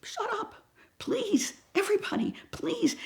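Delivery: high, hushed pleading whisper; frantic whispered please; desperate whisper. Retold or imitated. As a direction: beg them to be quiet, barely voiced. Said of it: "And I'm saying".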